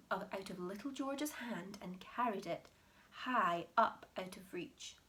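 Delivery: ordinary delivery, nothing marked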